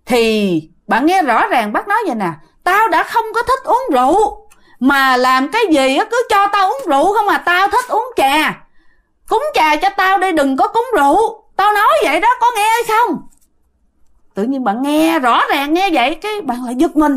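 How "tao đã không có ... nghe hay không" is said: retold scolding. Ghost uncle scolds his wife over rice wine, angry and high. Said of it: "đứng chửi thím của bạn".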